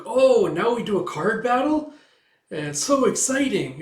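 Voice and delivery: put-on a child thrilled by a game; squeaky eager little girl; excited Anya. Anya thinks it's card battle.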